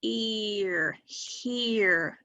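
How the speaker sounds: "Ear" then "hear", each with a drawn-out E; long E sound. Shown as a demonstration. Teacher demonstrating the vowel sound, "Ear, hear" with long E. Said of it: "I hear the long E sound".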